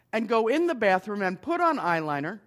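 normal talking voice

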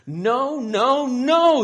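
very loud, high, escalating shouts; exploding anger. A narrator's reading. Isabella finding the disaster in the living room, exploding angrily. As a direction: explode with outrage. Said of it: "her anger just exploding".

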